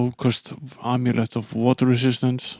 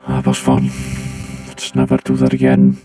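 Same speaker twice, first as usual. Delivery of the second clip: deep pitched-down robot voice; 8 semitones lower than usual. Acted. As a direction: talk like a voice-changed robot. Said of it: "Did you not like the robot voice".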